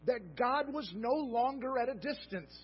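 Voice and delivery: impassioned sermon delivery; fervent preaching. Acted. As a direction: proclaim it to the congregation. Preaching at raised pitch.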